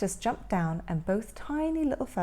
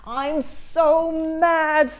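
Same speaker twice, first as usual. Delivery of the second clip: seething anger; 5 semitones higher, 7 dB louder, much slower than usual. Acted.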